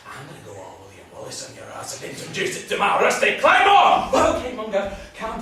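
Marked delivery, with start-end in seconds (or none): 0.1-4.1 threat building to a loud shout; hot-headed Highlander threat; threatening. Acted. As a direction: build up to a bellowed warning. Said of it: "not threaten each other".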